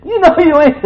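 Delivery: high, loud playful ribbing; teasing. Real, not acted. Preacher teasing a congregant about New Year's cookies, high playful jeer. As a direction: tease a friend across the room.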